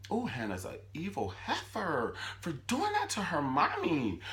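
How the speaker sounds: high, outraged evil heifer; indignant. Real, not acted. Indignant commentary.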